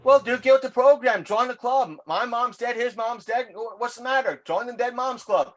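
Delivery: mock taunting shout. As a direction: rib the guy with a big grin in your voice. Retells taunting coworker loudly.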